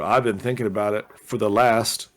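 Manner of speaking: ordinary talk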